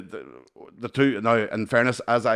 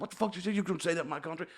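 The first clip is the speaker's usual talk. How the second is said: high, softer imitation of an aggressor; mock the offended man high and light; retold challenge; 8 semitones higher, 7 dB quieter, faster than usual. Man objecting to talk about his country, high imitated challenge.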